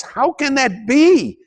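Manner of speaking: high, slow incredulous question; skeptical student. Acted. Lecturer voices a skeptical listener's question in a high, slow voice. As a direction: ask it like a doubting student.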